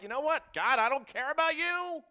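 loud, high mock defiance toward God; defiant sinner. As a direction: toss it off like a rebellious kid. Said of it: "when you were like".

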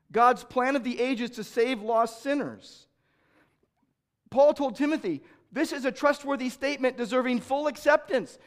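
loud, high, pressing sermon voice; forceful preaching. Acted. Preacher voices the anguish of Jesus' parents, loud preaching. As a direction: drive the point home from the pulpit.

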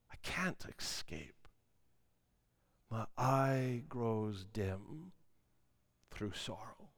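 preacher whispers the psalm's hopeless ending; whispered despair. Shown as a demonstration. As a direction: let the words die away in despair.

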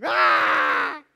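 long, very loud scream; sudden sustained yell; imitated scream. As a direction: scream full out, imitating the video. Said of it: "he just starts screaming".

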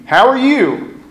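borderline lying. Retold or imitated. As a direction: chirp it up high, covering how you really feel. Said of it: "in my borderline lying".

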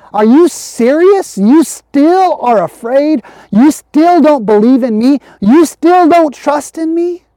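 high, slow incredulous questioning; disbelief. Acted. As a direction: ask as if you can't believe it. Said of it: "maybe in, in disbelief?".

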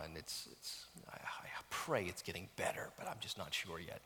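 whispered confession. Real, not acted. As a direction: drop to a real whisper. Confessing a personal struggle.

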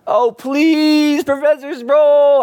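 loud, very high wailing plea; hammed-up begging, drawn out; mimicked begging. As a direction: wail a plea to the professor. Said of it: "I'm just kind of mimicking him".

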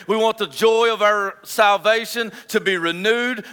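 earnest pastor's prayer building in fervour. Real, not acted. Pastor praying over his congregation before preaching, fervent prayer. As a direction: pray it over the congregation, rising toward the close.

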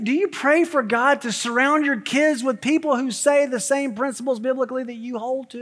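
ordinary talk

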